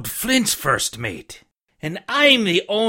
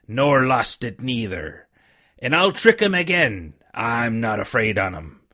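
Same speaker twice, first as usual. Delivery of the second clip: lower, rough-hewn pirate voice, wheedling; a weak old seaman coaxing the boy; dying buccaneer begging the boy for rum; old sick sea captain; 4 semitones lower than usual. Acted. Captain's defiant rant.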